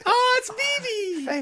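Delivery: high, loud drawn-out squeal of greeting; high-pitched, excited cartoon cry; Ed calling out. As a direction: squeal the name in an excited kid voice. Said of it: "Sounds like Ed talking to him".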